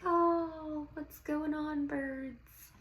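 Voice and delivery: high, dreamy, drifting voice; lost in the birds, sighing to them; dreamy Maya. Acted. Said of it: "She's like".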